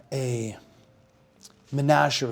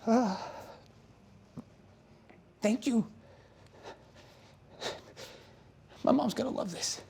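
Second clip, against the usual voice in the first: put-on high, soft grateful boy; grateful kid; 10 semitones higher, 4 dB quieter than usual. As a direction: thank her with a lump in the throat. Boy thanks her, moved.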